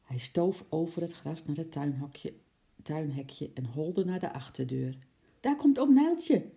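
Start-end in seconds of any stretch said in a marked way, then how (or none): 5.4-6.5 high, loud excited call. A narrator's reading. Girl calls out, spotting the running zookeeper, loud and high. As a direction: call out the news to the house. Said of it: "riep Lotje".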